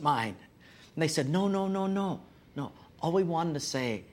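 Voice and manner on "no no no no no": ordinary delivery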